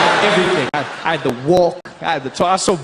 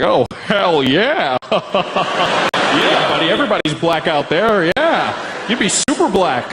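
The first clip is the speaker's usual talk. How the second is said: slow, drawled American buddy voice, laughing; amused, slow American drawl; laid-back American; much slower than usual. Retold or imitated. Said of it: "He was like".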